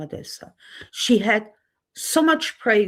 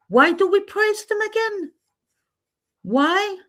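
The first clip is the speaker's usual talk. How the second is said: high, exasperated demand; 11 semitones higher than usual. Real, not acted. Vlogger protesting who gets praised, exasperated. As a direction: demand an answer in disbelief. Indignant rant about praise.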